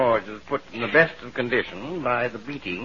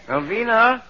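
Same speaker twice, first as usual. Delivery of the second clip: high, slow, plaintive call of a dying man; calling weakly from a sickbed, hammed up; feigning illness; 9 semitones higher, slower than usual. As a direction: fake being very ill. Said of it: "as if you were very, very ill".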